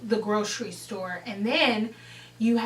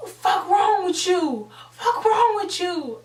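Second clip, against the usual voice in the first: higher, louder retold outrage; going hard; 8 semitones higher, 4 dB louder than usual. Friend going hard at the pusher, angry retold outburst. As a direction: lay into them, repeating it loudly. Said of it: "really going hard".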